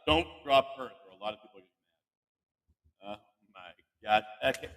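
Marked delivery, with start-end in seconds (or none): none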